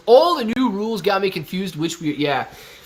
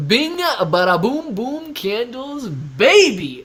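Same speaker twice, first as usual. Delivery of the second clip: slow, drawn-out, higher hype chant; hammed-up hype; 6 semitones higher, 4 dB louder, much slower than usual. Real, not acted. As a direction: ham up the bada boom.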